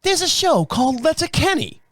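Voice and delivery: high-pitched mock British bartender; Scottish-turned-British accent. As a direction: imitate a Scottish woman, sounding British. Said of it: "it's gonna sound British, I know".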